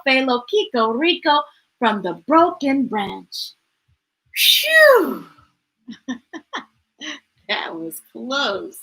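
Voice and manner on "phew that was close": relieved. A narrator's reading. Relief after rescue.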